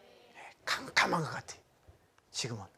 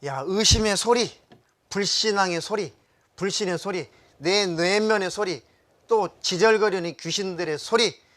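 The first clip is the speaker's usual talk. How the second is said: forceful rebuke; 4 semitones higher, 5 dB louder than usual. Acted. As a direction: command the demons like a revival preacher. Rebukes voices of doubt and demons.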